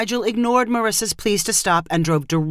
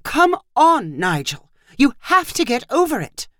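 higher-pitched pleading insistence; exasperated urging; 7 semitones higher than usual. A narrator's reading. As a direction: push an unwilling husband, pitch lifted. Wife urging husband in argument.

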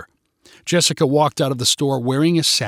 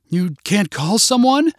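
high, slightly raised narrated shout; mild shout of disbelief, pitched up; indignant shout; 6 semitones higher, 3 dB louder than usual. A narrator's reading. Customer challenges the manager about the pockets, indignant mild shout. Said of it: "shouted Jessica".

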